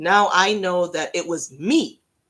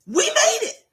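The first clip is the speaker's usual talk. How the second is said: very high teary squeal of joy; overjoyed squeal; 5 dB louder than usual. Retold or imitated. Fan seeing the band's gas-pump ad, teary high squeal. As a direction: squeak it out near tears.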